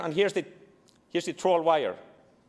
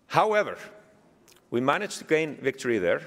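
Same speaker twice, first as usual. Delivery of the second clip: ordinary talk, nothing marked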